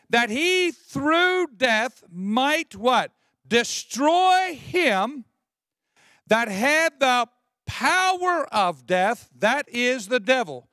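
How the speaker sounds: slow, forceful scripture declaiming; preached scripture. Acted. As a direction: stretch each key word and ring it out to the congregation.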